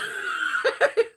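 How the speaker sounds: high breathless laugh that bursts out; burst of laughter. Real, not acted. Laughing while telling friends about the punch. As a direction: cracks up at the memory.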